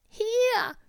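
very high, loud and slow, a pitiful poorly tiger; a hammed-up sick tiger voice, high and drawn out; sick little tiger. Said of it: "sagte der kleine Tiger".

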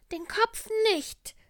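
very high, loud and slow, a pitiful poorly tiger; a hammed-up sick tiger voice, high and drawn out; sick little tiger. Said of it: "sagte der kleine Tiger".

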